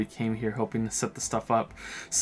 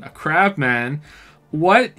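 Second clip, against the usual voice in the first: slow, then high loud disbelief; incredulous; 4 semitones higher, 7 dB louder, much slower than usual. Real, not acted. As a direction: question it in disbelief. Disbelief at crab man.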